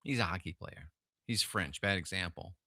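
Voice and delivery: quiet self-correction after the bit; sheepish aside. Real, not acted. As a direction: undercut your own joke quietly.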